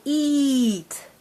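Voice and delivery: "eat" with the vowel drawn out; said slowly. Shown as a demonstration. Teacher modelling a word for a child, said slowly. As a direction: stretch "eat" slowly before the final t. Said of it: "say the word slowly".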